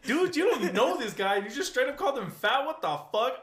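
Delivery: loud, heated telling-off of a rude stranger; angry rant. Retold or imitated. Chewing out the friend's boyfriend for calling Billy fat, loud and angry. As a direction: tear into him, full volume. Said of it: "I tore into him".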